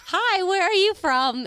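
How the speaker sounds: put-on high, slow sweet old lady; high mock-friendly old lady greeting. Old lady voiced in story.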